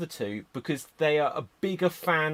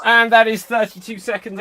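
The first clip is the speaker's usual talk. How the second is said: loud time call; 7 semitones higher, 13 dB louder than usual. Real, not acted. Host calling time on the improviser, loud.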